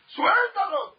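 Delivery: very high, loud yelled command; the rabbi yells at the young man with full force; full shout. Retold or imitated. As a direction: scream the order at him. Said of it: "Tres, cuatro veces le gritó".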